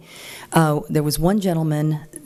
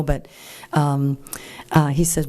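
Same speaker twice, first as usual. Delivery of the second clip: usual speaking voice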